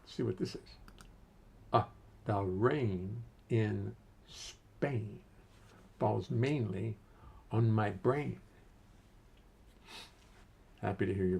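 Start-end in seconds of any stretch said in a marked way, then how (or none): 1.7-8.4 slow playful parody. Shown as a demonstration. Playful test phrase parody.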